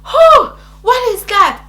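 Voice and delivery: high, loud shocked question; startled cry. Retold or imitated. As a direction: act out sudden pained alarm. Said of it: "And I screamed".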